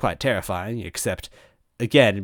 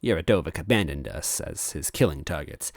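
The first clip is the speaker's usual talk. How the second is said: usual speaking voice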